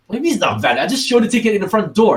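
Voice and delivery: mock-indignant retold complaint; indignant protest. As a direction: argue back as if wronged at the gate. Arguing with ticket staff.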